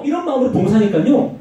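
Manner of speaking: usual speaking voice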